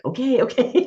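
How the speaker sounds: half-laughing startled okay; laughing mock alarm. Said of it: "and I'm like".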